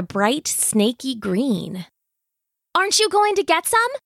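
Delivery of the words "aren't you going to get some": put-on high, quick little-girl question; young girl's hopeful question; eager child asking. A narrator's reading. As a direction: ask parents eagerly, pitched way up. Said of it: "Jenny asked".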